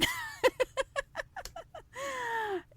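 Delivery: high, soft trailing laugh; real laugh. Real, not acted. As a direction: laugh high and airy at your own tease. Laughs at own teasing remark.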